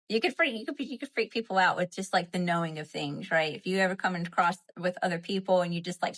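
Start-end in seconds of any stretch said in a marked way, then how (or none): none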